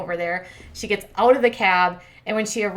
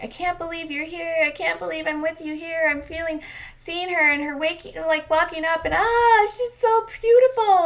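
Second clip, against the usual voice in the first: mock lovestruck awe; 9 semitones higher than usual. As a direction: gush like a besotted suitor, hammed-up. Acting out David's awe at meeting Lana.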